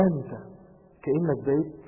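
ordinary talk, nothing marked

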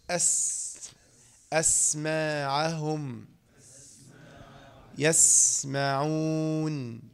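"أسماعهم" and "يسمعون" very slow with held س; whistling sibilant. Shown as a demonstration. Teacher shows safir on seen, whistled "أسماعهم". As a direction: softly draw out the hiss in "أس". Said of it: "أيضًا فيها صفة الصفير".

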